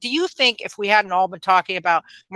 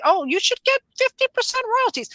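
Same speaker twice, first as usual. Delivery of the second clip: squeaky high mock voice, much higher; mocking Millar. Host lampooning Millar's royalty pitch, high and mocking. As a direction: toss it off like a flashy promise.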